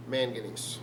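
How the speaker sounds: usual speaking voice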